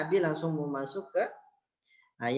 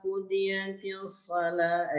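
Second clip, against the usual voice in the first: slow, higher chanted reading; Quran recitation; 7 semitones higher, 6 dB louder, much slower than usual. Shown as a demonstration. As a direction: intone the verse slowly. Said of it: "Abi bacakan dulu ya". (lantunan ayat pelan)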